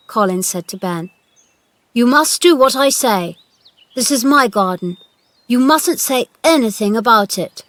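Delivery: high, loud orders; commanding young master. A narrator's reading. Boy giving the gardener orders, commanding. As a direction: lay down the law.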